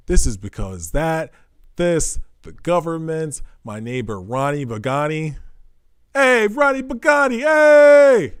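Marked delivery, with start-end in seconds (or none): 6.1-8.3 loud, high mock Italian shout; big comic Italian-accent yell. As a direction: holler at a neighbour in a hammed-up Italian accent. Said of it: "you can do an Italian accent".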